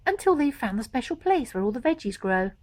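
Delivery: plain reading voice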